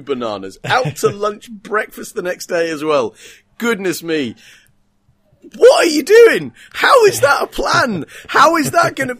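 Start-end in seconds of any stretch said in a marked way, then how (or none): 5.6-9.1 high, loud disbelieving rant; exasperated shout. Real, not acted. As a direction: yell at the screen in disbelief. Ranting at the film's plan.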